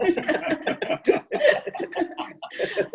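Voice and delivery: a long, very high breathless laugh; high airy laugh. Real, not acted. Guest laughing about a Spanish accent from I Love Lucy. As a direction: burst out laughing at the idea.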